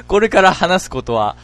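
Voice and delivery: slow, deliberate ominous narrator; mystery story narration. Acted. Telling listeners of a village school disappearance, ominous narration. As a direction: introduce a strange true tale slowly.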